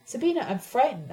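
ordinary delivery

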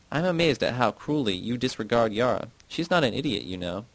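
plain reading voice

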